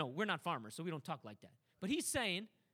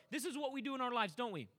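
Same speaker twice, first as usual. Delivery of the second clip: ordinary talk, nothing marked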